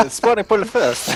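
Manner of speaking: laughing through the words. Real, not acted. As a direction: chuckle out spawning pool first.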